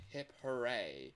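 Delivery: usual speaking voice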